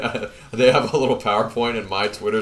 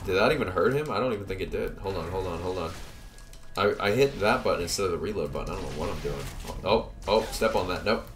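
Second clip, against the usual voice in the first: ordinary talk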